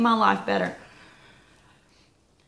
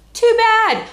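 sharp, much higher and louder; emphatic too bad; 13 semitones higher, 9 dB louder than usual. Real, not acted. Dismissing picky kids, loud and high. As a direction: blurt it out, no sympathy.

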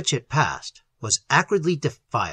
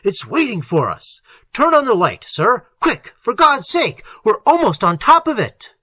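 high, loud, frantic command; urgent cry; 8 semitones higher, 6 dB louder than usual. A narrator's reading. Little ordering the light on as the car closes in, urgently crying out. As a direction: build to an urgent shout. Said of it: "cried Little".

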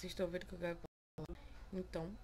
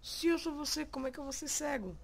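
mock argument; 6 semitones higher, 4 dB louder than usual. Arguing with his reflection.

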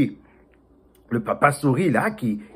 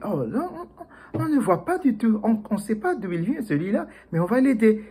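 amused; 10 semitones higher than usual. Acted.

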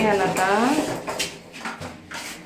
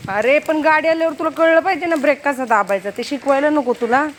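ordinary talk, nothing marked

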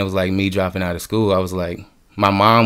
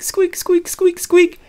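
very high thin squeak, like a paint pen on canvas; squeaky pen noise; 3 dB louder, much slower than usual. Retold or imitated. Imitating his squeaking paint pen.

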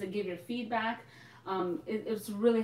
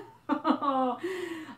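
high laugh; 6 semitones higher, 3 dB louder than usual. Real, not acted. Laughing at own mistake.

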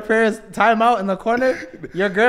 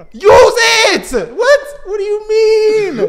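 high, loud shout of disbelief; hammed-up outraged holler; exasperated mock shout; 14 semitones higher, 7 dB louder, much slower than usual. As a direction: yell it at the parents, pitched way up. Acted rant at parents about PTO.

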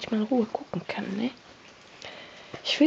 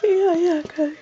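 excited; 7 semitones higher, 4 dB louder, slower than usual. Real, not acted. Cheering the pet.

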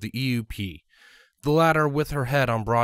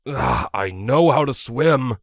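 louder, slow exasperated groan; 2 semitones higher, 6 dB louder, slower than usual. A narrator's reading. Broadsword defending himself to his friend, exasperated groan. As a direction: drag it out, fed up. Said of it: "Broadsword groaned".

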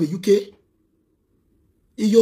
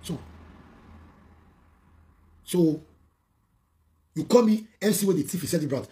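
usual speaking voice

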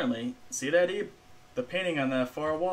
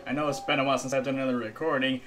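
ordinary talk

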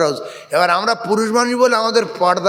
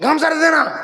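higher-pitched call out to mum; son calling mother; 7 semitones higher than usual. Retold or imitated. Half-naked son asking his mother for a towel, calling out. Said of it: "বলছে".

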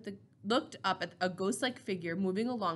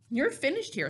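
firm verdict, pitched higher; hard and absolute; 6 semitones higher than usual. A narrator's reading. Elder tells the teen he is finished, hard and absolute. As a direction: state it as final, no room for argument. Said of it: "his voice hard and absolute".

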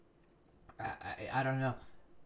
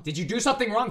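high, louder interrogation; accusing question; 8 semitones higher, 9 dB louder, faster than usual. Acted. Interrogating someone about doing wrong, loud and accusing. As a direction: press the question harder, pitch raised.

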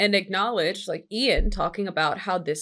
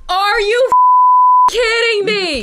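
very high, slow, loud disbelief; can't-believe-it scream at the film; shocked shriek; 14 semitones higher, 12 dB louder, slower than usual. Real, not acted. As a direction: shriek it at the screen. Disbelief at the movie scene.

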